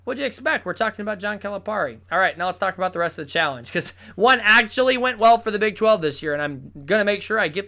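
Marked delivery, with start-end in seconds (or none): none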